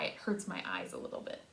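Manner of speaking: usual speaking voice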